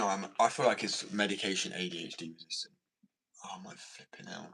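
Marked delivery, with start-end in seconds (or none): 3.4-4.5 breathy whispered oh my flipping hell; whispered exasperation. Real, not acted. Whispering to himself at his own restlessness. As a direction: whisper it under your breath, fed up.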